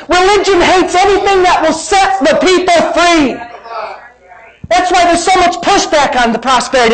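high, impassioned sermon delivery; fired-up preacher at full voice; forceful preaching. Real, not acted. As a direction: drive each point home to the congregation.

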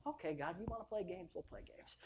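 very soft, trailing into a near-whisper; imitating a hushed private retort to God; muttered concession. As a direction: mutter it under your breath. Muttered reply to God, acted.

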